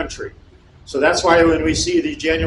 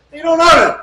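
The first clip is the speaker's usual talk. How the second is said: real angry shout, much higher and louder; 13 semitones higher, 8 dB louder than usual. Real, not acted. Fed up with lawmakers who cave, real angry shout. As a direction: blow up at them, really pissed off. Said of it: "start to get emotional 'cause I really get pissed off".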